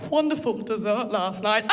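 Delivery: mild prim British lady again; understated English politeness. Retold or imitated. Wife repeating her line in prim British. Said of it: "So she talks, and there's subtitles".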